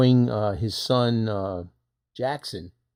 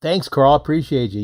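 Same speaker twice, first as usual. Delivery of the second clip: loud thanks; 6 semitones higher, 10 dB louder than usual. Real, not acted.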